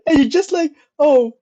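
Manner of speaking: loud, high words through laughter; real laughter while picturing the scene; laughing hard. Real, not acted. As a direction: laugh while you talk. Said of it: "That's so fucked up".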